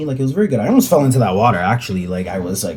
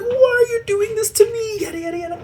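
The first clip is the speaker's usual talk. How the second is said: high mock sobbing plea; hammed-up crying squeal; crying victim. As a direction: wail it like a hurt man. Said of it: "the guy's like".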